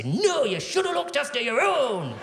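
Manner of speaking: high, swooping mock outrage; hammed-up scolding of a begging neighbour; indignant refusal. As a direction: wail the refusal, pitched high. Hoarder's acted refusal to neighbours.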